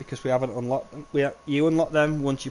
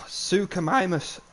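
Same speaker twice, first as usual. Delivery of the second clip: voice crack; 5 semitones higher, slower than usual. Real, not acted. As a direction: voice cracks up high on the word. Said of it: "Sorry about my voice crack".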